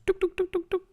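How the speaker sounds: quick repeated "touc" sound effect; little percussive mouth noises; imitated tapping noise. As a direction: mimic dabs of pouring, touc-touc-touc. Imitates adding an ingredient.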